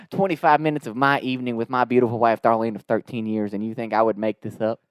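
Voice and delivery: straight-faced Southern customer taking offence; Southern twang. Retold or imitated. Prank caller answers the doubting manager, Southern twang. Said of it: "this Southern twang".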